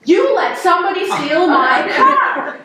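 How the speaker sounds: high, loud indignation; imitated outraged mother. Mother learning her car was stolen, imitated loud outrage. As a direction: hammer it out as an appalled mom.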